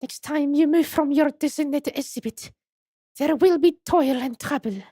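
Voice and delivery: put-on high French-accented warning; Esmeralda Tuspels. Madame warns her wayward exhibit, high French accent. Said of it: "Okay, it's French".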